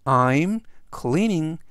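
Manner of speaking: very slow, careful English reading; slow model sentence. Shown as a demonstration. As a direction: read it out word by word for learners. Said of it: "활용 문장 보시겠습니다". (아주 천천히 또박또박 읽는 예문)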